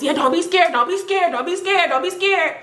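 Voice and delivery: high, light imitation of a friend reassuring; playing the homeboy coaxing her along; mock reassuring friend.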